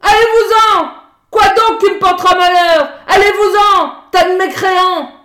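trembling with rage, screaming at them; screaming in fury. A narrator's reading. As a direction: howl them out of the house.

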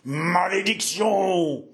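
slow, high, drawn-out curse; a high, slow exclamation of doom; cursing cry. Acted. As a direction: spit the curse out slowly.

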